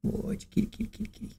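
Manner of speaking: breathy whisper calling the cat; hushed pet call; whispered kitty call. Real, not acted. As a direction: whisper softly to lure the kitty.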